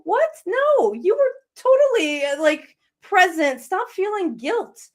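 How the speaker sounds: high, insistent imitation of a protesting grandmother; acted, incredulous protest on the grandmother's behalf; emphatic reassurance. Voices grandmother dismissing the guilt.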